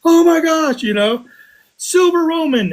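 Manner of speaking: high excited shout across the field; excited yelling. Retold or imitated. Detectorist yelling to the closest guy, high and excited. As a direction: yell it out, thrilled, over a hundred yards. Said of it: "and I'm like".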